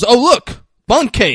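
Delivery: high, loud mock discovery; hammed-up sudden delight; fake distraction. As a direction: call it out to divert attention.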